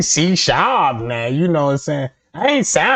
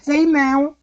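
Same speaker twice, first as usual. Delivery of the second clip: put-on higher, lighter greeting; lightened voice; 10 semitones higher than usual. Comic lightening his voice to pass as female, high and light. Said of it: "had to light my voice and be like".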